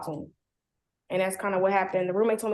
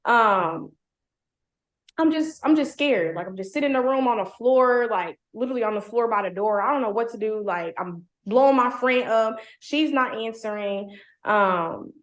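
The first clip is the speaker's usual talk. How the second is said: usual speaking voice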